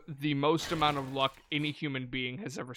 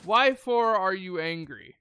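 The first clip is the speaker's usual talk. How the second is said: louder, playful why-for to a growling zombie; mock-baffled question; 4 semitones higher, 7 dB louder, slower than usual. Player teasing a growling zombie, loud mock-baffled. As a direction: tease the angry zombie with a loud silly question.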